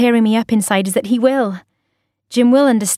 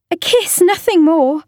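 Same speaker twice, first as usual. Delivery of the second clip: tearful high confession; 8 semitones higher than usual. A narrator's reading.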